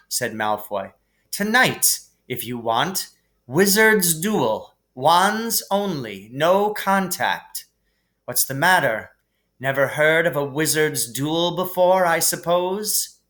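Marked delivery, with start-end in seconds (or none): 1.3-7.7 Malfoy spells out the duel, slow and higher; slow, sneering challenge. Acted. Malfoy challenges Harry to a slow, sneering wizard's duel. 8.2-13.2 a hint of a drawling sneer, slightly higher; mock him from above; lightly voiced sneering Malfoy. Malfoy taunts Harry at dinner with a light sneer.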